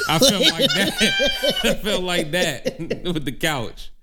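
high squeal into laughter; bursting out laughing mid-sentence. Real, not acted. Cracking up at own story.

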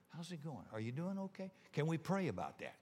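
soft caring greeting; gentle check-in. Shown as a demonstration. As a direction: ask softly like you really care. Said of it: "Ask people how they're doing".

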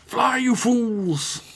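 slow, drawn-out wizard voice; a dramatic wizard cry; Gandalf impression. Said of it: "Gandalf scene quote".

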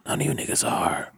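dropping to a whisper. Real, not acted. Ranting, drops to whisper.

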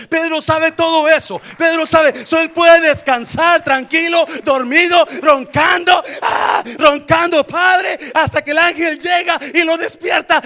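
shouted, high, relentless sermon; preacher at full shout; full-force preaching. Real, not acted. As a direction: build to a roaring climax and hold it there.

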